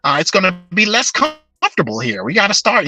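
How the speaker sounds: mocking the GM. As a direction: lightly impersonate a complacent general manager. Mocking the GM's speech.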